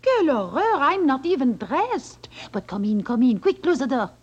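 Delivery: a lightly voiced flustered French maid; breathless, coy French accent.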